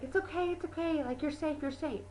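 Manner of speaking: gently calm a panicked friend; imitated reassurance. Gale calming Katniss after she freaks out, soft reassurance.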